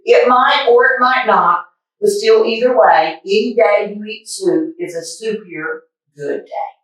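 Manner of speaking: lively picture-book read-aloud; reading a story to kids. A narrator's reading. Reading a picture book to boys and girls, lively storytime voice. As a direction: read it like storytime for little ones.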